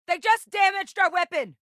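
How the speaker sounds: very high-pitched urgent yell; alarmed cry. Acted. Warning crew the weapon is damaged, alarmed cry.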